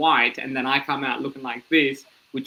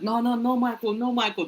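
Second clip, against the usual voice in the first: high-pitched learner's English; broken beginner English; 9 semitones higher than usual. Retold or imitated. Newcomer refusing a name, in broken beginner English. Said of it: "That was my English at the time".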